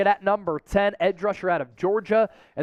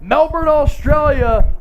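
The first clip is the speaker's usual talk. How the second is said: excited callout; 6 semitones higher, 8 dB louder, slower than usual. Real, not acted. Hyping an Australian viewer.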